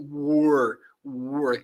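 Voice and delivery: "were" with a rolled-out, carried R; strong R. Shown as a demonstration. Teacher stressing the R of "were". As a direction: carry the R of "were". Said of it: "a gente capricha nesse R".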